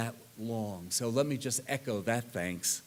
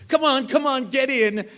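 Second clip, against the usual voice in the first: high, loud, urgent beckoning; eager hurry-up; 11 semitones higher, 9 dB louder than usual. Retold or imitated. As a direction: wave the friends into the car with a keen call. Ted hurrying friends into car.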